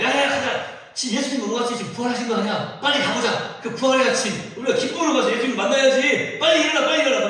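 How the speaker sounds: loud, high, eager cries to get up; Mary waking the disciples, loud and high with joy; excited calling out. Pretend. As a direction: rouse sleepers with joyful urgency.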